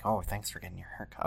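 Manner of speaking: very soft ASMR murmur; ASMR whisper. Pretend. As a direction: hush it like an ASMR haircut video. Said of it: "like, an A S M R".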